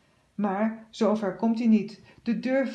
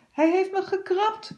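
put-on high, squealing little boy's cry; 10 semitones higher, 3 dB louder than usual. A narrator's reading. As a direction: squeal like the scratched boy. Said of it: "gilt hij". (een hoge jongensgil)

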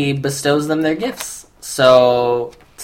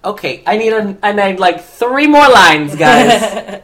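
higher, louder mock plea; mock tired writer; 7 semitones higher, 6 dB louder than usual. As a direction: beg for more lines, hammed up. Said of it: "And I'm like".

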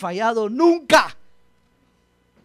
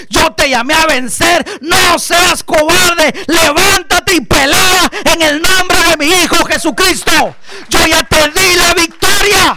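booming voice of God; 7 semitones higher, 12 dB louder than usual. Acted. Pastor voices God ordering the fearful to fight. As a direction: thunder it down as God's command. Preacher voices God's command.

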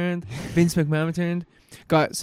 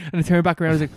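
ordinary talk

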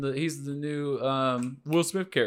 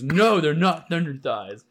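mock-indignant, higher and louder, dragged out; upset retort; 5 semitones higher, 7 dB louder, slower than usual. Retelling a date's objection to the nickname, mock upset. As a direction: act out her upset, slow loud denial. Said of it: "getting more and more upset at me".

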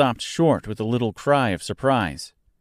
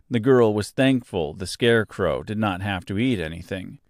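plain reading voice

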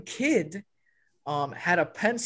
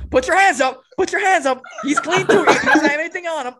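loud, high urgent orders; frantic imitated shouting; 10 semitones higher, 10 dB louder, faster than usual. Classmate pointing at storyteller by drug dog, imitated frantic shouting. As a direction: yell it like a panicked classmate. Travis shouting at narrator before officer.

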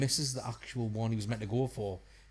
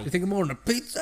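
Bruce Willis twang; 7 semitones higher, much faster than usual. Retold or imitated. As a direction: mimic the actor's exasperated sneer. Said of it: "in the Bruce Willis twang".

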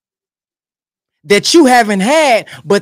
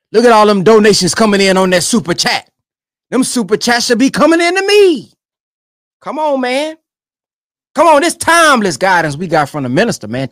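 ordinary talk

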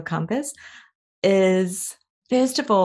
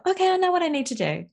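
relieved student; 10 semitones higher, much faster than usual. Retold or imitated. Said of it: "that basic understanding of".

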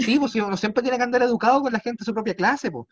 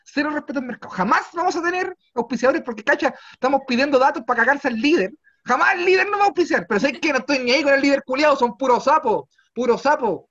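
louder, higher rant against the supermarket; mock rant; 6 semitones higher, 4 dB louder than usual. Real, not acted. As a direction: work yourself up into a fake rage. Ranting about the supermarket.

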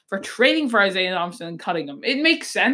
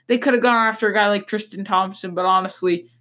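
usual speaking voice

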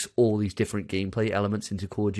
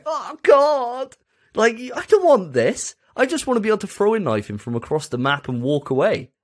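pained whimper; 10 semitones higher, 3 dB louder than usual. Pretend. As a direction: whine in mock agony. Soldier shot again, in pain.

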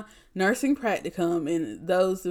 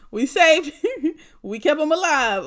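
laughing through the words, much higher and louder; 9 semitones higher, 6 dB louder than usual. Real, not acted. Nurse glad her patient survived, laughing much higher and louder. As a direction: giggle with relief and pride.

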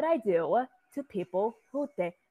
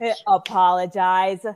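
stern demand; 7 dB louder, slower than usual. Acted. Demanding apology.

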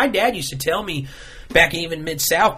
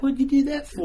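dazed victim voice; 10 semitones higher than usual. Retold or imitated. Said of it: "you'll be like".

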